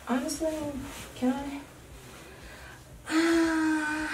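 breathless, gasping between words; struggling to get breath out; gasping for air. Real, not acted. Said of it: "why am I out of breath".

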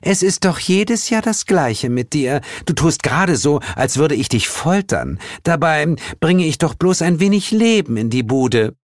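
high-voiced, aggrieved scolding; exasperated sweet goddess; reproachful. A narrator's reading. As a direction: chide a cousin who overdramatizes. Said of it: "meinte Ostara tadelnd".

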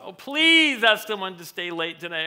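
hammed-up begging to get out of it; mock pleading. Worker asking the boss to pick someone else, mock pleading.

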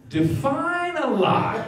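dodging the doctor's drinking question, high and slow; evasive dodge. Retold or imitated. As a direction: stretch it out, playing innocent. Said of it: "So I was like".

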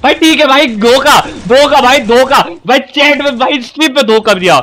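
high, loud cry of betrayal; worked-up accusing call; indignant outcry. Real, not acted. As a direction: protest the betrayal to everyone watching. Protesting betrayal on stream.

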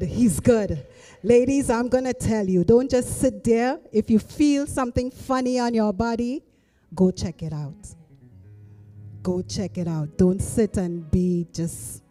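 normal talking voice

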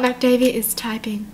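breathy unvoiced whisper. Shown as a demonstration. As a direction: hush it like a secret. Reads notice in whisper.

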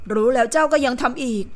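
usual speaking voice

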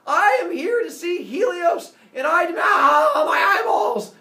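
high, very loud heroic demand; storming-in shout. Acted. Phaethon storming into the sun god's palace, shouting. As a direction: burst in yelling at the top of the range. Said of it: "storms inside and says".

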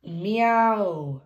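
long arching cat call; cat meow. Acted. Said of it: "a cat".

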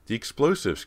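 high, loud outburst; urgent cry. A narrator's reading. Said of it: "Cody cried".

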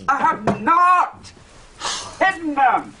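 indignant denial. Acted.

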